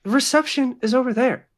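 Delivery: high-pitched, irritated character voice; irritated nurse. Acted. Nurse with clipboard to intruders, irritated. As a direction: pitch it up and dismiss them.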